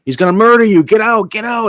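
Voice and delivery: high, loud panicked shout; mock ringside yell. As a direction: yell at him to get out of there. Trainer yelling from ringside.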